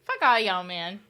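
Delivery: loud playful griping at the chat; fake-angry rant, half laughing; mock outburst. Streamer mock-cursing her chat, loud and playful.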